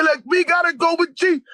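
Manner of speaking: very high insistent plea, retold; fired up. Pleading with the possessed friend, retold fired-up cry. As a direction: push on urgently in a high cry. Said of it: "I was fired up".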